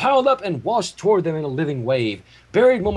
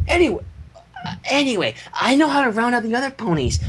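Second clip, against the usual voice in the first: Fluttershy voice; 7 semitones higher than usual. Acted. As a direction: speak gently like Fluttershy. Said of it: "sounding much more plausibly like herself".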